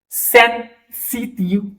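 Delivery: सेंसिटिव said slowly, syllable by syllable, without dragging; French word demo. Shown as a demonstration. Teacher modelling सेंसिटिव for the class, slow and plain. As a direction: sound out सेंसिटिव carefully, no stretching. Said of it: "मतलब खींचना नहीं है".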